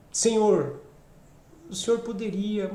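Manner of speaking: hushed, halting plea with a long pause; hesitant, humble sorrow, left unfinished; prostrate lament. Acted. Someone prostrate before the Lord, softly lamenting. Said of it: "era um lamento prostrado".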